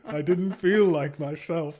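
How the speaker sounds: ordinary talk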